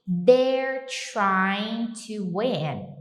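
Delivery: drawn-out, careful English; very slow model line. Shown as a demonstration. Teacher drilling the phrase very slowly for students. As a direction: stretch every word slowly. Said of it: "teacher modelling".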